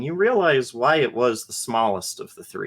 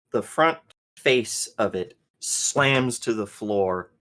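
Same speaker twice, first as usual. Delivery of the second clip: ordinary talk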